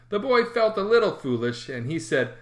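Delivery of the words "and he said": usual speaking voice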